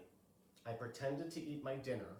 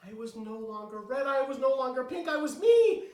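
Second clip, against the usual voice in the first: climbing higher and louder to delight; joyful build; 7 semitones higher, 12 dB louder than usual. Acted. Girl cheering at being herself again. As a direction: rise into pure joy.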